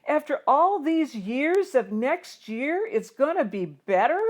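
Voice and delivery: high, loud, mocking the old promise; bitter shout. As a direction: throw the words back at him. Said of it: "I shout".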